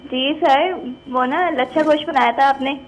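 usual speaking voice

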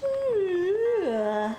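yawning through the word; long open yawn. Real, not acted. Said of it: "sorry I'm yawning so much".

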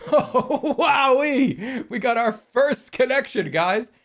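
high, loud, laughing celebration; giddy excitement. Real, not acted. Streamer celebrating first puzzle connection to chat, giddy. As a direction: squeal it with glee.

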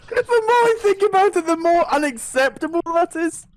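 high, lively indignant rant; rising outrage. Real, not acted. Friend ranting about the nail gift, high indignant. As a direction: pitch up with mock outrage.

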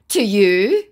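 acted indignant question; loud scammer retort. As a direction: throw it back loudly as the scammer. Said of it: "your eardrums going".

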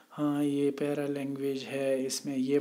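a sad, low, tired demo; very dejected. Shown as a demonstration. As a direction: sound defeated and drained. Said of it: "मतलब बहुत मायूसी से".

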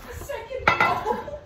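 high, slow teasing repeat; mocking echo. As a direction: parrot her words back, drawn out. Mocking repeat of friend's request.

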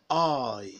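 "I" drawn out, gliding from open to close; long I diphthong. Shown as a demonstration. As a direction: model "I" slowly for repeating, tune falling. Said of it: "mouth moves from open to closed position".

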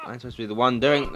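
ordinary delivery

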